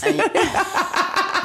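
high burst of laughter; high, delighted laughing; real laughter. Real, not acted. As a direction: laugh hard at the teasing. Laughs at mock praise.

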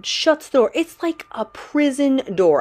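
high, loud and slow for drama; dramatic alarm. Retold or imitated. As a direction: play up the horror of the slam. Horrified retelling of door.

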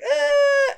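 high mock-frightened wail; imitated whimper. Other camp girl hearing thunder, high mock whimper. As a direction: imitate a scared girl's cry.

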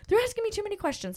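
high-pitched hurried impression of a flustered father; frantic dad. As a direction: squeak it out, rushed and overwhelmed. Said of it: "he's like".

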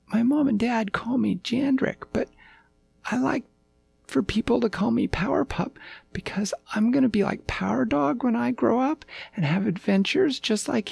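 put-on high-pitched childlike pup voice; eager little puppy. A narrator's reading. Puppy explaining his nickname to the king, high and eager.

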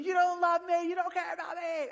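high mock child wail, you don't love me; a son's mock tantrum cry, retold by dad; imitating screaming son. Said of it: "I heard him screaming".

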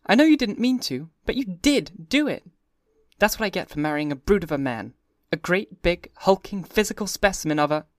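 high, light mock scolding; teasing Daisy. A narrator's reading. Daisy teasing her husband about being a brute, high mock scold. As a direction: scold him half in jest, high.

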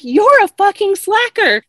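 high, loud imitation of an irate client; retold angry insult. Refused client swearing at her hairdresser, mock-angry outburst. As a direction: hurl the insult, mimicking her outrage.

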